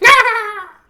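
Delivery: high, loud shrieking crone cackle; mad cackle. Acted. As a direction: burst out in a mad witch laugh. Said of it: "cackling laughter bursts like mad lightning".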